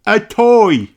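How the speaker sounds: put-on high, loud child shout; little Danny Dog voice. Danny calling out his idea to teacher, loud and high. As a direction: blurt it out eagerly.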